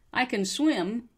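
"can" weakened to "kun"; reduced can. Shown as a demonstration. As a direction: model "can" as a quick "kun". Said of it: "that can turns into kun".

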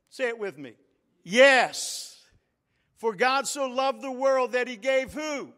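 loud, high call for response; rally the church to answer. Real, not acted. Preacher calling the congregation to respond, loud.

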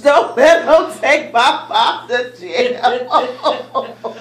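slow, laughing through the plea; can't keep a straight face. Real, not acted. Laughs while retelling prayer.

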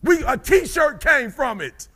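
high, loud shouted sermon; preaching at full force. Acted. Speaker telling the crowd about his wife's diagnosis, shouting high. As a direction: build it to a roar.

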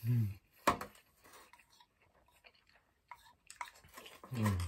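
humming while chewing noodles; muffled through food; mouth full, chewing. Real, not acted. Seen 0: Slurps noodles just before, then chews while stirring bowl.

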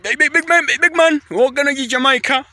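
high-pitched mock Jamaican hype voice; Jamaican accent imitation. Vlogger demonstrating the accent to viewers, high mock Jamaican accent. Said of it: "I think that's the accent".

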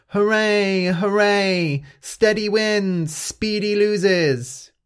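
high, loud cheering, slowing at the end; cheering shout. Acted. The animals celebrating Steady's win with high, loud cheers. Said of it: "All the other animals shouted".

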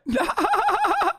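sudden high burst of laughter. Real, not acted. Bursts out at MSNBC quote.

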